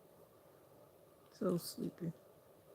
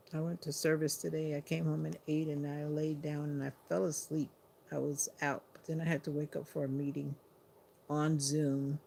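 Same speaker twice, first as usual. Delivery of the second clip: ordinary talk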